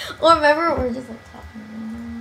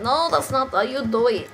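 mock correction; 4 dB quieter than usual. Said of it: "I'm like".

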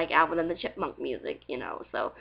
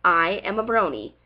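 ordinary talk

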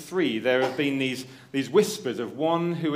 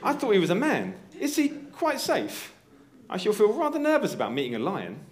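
nervous Susan; 7 semitones higher than usual. Acted. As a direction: play a nervous girl asking about the lion. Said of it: "reading a story at dinner time voice".

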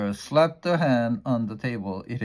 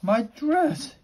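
much higher shocked cry of a wife; shocked exclaim. Acted. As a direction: gasp it out in dismay. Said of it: "exclaimed Missus Rogers".

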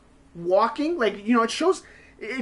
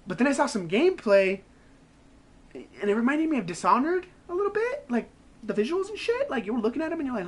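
usual speaking voice

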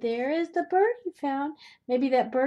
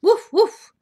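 high, loud woofing; two sharp high barks, acted out; dog barks; 9 semitones higher, 13 dB louder than usual. Grandmother reading Biscuit book to children, dog barks.

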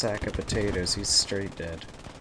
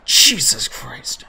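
slow breathless whisper after a gasp; awed whisper; 3 semitones higher, 10 dB louder, much slower than usual. Real, not acted. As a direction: gasp then whisper, slowly. Another close explosion.

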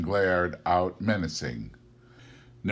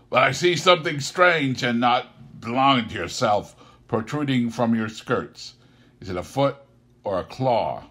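Scrooge; 5 semitones higher, 5 dB louder than usual. Acted. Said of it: "said Scrooge".

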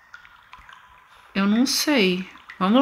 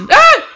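startled scream; 10 dB louder than usual. Real, not acted. Startled in horror game.

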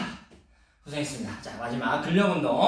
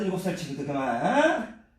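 ordinary talk, nothing marked

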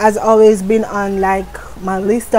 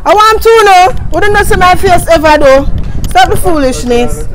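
loud; 7 semitones higher, 5 dB louder than usual. Real, not acted. Loud excited exclamation.

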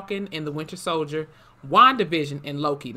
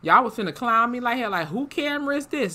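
raised, exasperated quote of himself; indignant retold rant, pitched up; mock outrage; 7 semitones higher than usual. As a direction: act out refusing to post bad footage. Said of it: "And I'm like".

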